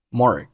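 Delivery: "Mark" with the final k unreleased; swallowed k. Shown as a demonstration. Teacher showing learners lost plosion, "Mark" with silent k. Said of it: "把声音给吞掉".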